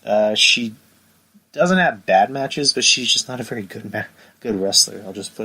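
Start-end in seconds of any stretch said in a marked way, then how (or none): none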